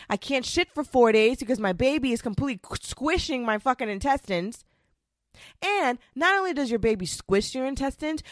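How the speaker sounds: normal talking voice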